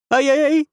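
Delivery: high yelping cry of pain. Acted. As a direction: yelp as if bitten on the leg. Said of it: "воскликнул колдун".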